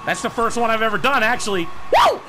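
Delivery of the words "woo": a high, loud cheer of triumph; excited whoop. Real, not acted. Gamer celebrating his first air dribble, excited whoop. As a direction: let out a victory whoop.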